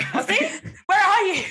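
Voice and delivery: high, eager call-out; mock excited calling. Shopper hearing an Aussie accent, mock excited calling. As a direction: pipe up loudly as if searching.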